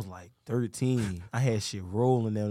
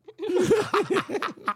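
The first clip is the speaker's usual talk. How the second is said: high laugh; 3 dB louder than usual. Real, not acted. Laugh after cherry.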